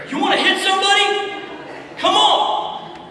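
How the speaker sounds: high, loud mock challenge; dad yelling a challenge. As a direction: shout it like picking a fight. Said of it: "he goes".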